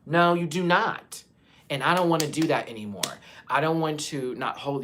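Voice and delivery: real rising anger; defiant shout. Real, not acted. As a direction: slam the door on it. Defiant outburst.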